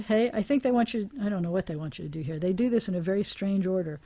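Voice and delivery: ordinary talk, nothing marked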